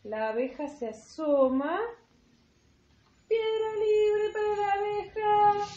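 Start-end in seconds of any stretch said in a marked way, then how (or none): 3.3-5.7 calling out. Acted. Calling out found bee in game.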